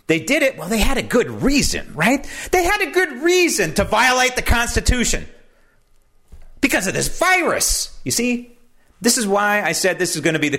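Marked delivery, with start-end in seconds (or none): 0.1-8.0 high, sarcastic mimicry of their excuse; mock excuse. Host mocking the document's authors, sarcastic high voice. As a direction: sneer out their so-called good reason.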